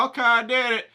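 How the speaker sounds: high, loud, mock-indignant voice. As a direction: ham up the outrage at no payback. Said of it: "I'm like".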